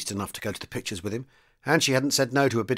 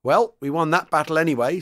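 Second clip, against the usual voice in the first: mildly wry remark, pitched up and louder; 5 semitones higher, 5 dB louder than usual. A narrator's reading. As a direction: sound wry with raised voice. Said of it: "said Donovan wryly".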